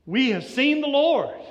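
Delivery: high and loud, bursting with the news; excited announcement. Acted. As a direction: shout it like you can't keep it in. Disciples excitedly telling Thomas.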